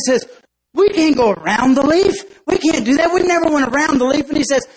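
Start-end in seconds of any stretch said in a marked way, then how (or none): 0.7-4.3 high, loud protest; panicked ant. Acted. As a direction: refuse in a panic. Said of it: "he completely freaks out".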